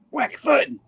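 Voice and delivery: high-pitched comic-book impact noises; imitated blows. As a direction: punch out each fight sound effect. Reads out fight sound effects.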